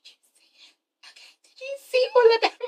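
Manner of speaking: breathless hushed whisper; gossipy whisper. Acted. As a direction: lean in and whisper the juicy part. Whispered gossip about abs.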